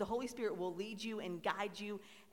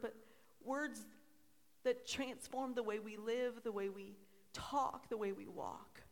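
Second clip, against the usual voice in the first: heartfelt prayer; 4 semitones higher than usual. Real, not acted. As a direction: pray over the congregation in weighty, spaced phrases. Leads the congregation in prayer.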